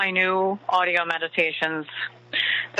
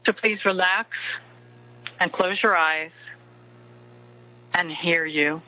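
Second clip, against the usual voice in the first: right in your ear. Acted. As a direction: murmur close like a lover at bedtime. Leads a bedtime love meditation.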